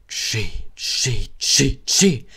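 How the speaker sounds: loud train chuffs, rising in force; engine puffs. Acted. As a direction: imitate a little steam engine. Impatient engine puffing and whistling.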